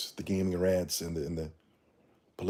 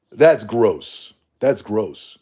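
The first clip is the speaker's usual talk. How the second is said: loud, disgusted repeat; 11 dB louder, much slower than usual. Real, not acted. Disgusted at egos.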